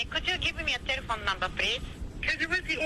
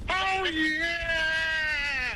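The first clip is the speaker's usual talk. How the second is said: ecstatic shout. Acted. Ecstatic reply to each item.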